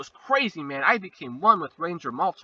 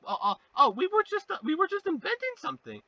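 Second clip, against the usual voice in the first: mock panic; 13 semitones higher, faster than usual. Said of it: "they panic like a bunch of idiots".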